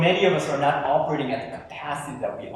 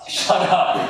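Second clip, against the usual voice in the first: irritated; 3 dB louder than usual. Shown as a demonstration. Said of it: "you're just mad now".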